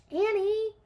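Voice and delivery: high, loud call of her name; loud call across the room. A narrator's reading. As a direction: call after her as she runs off. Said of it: "called Jack".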